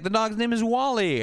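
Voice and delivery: put-on high girl voice, sure of herself; high, prim announcement. Brenda naming the dog, high and prim. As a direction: announce the name high and pleased. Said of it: "She's like".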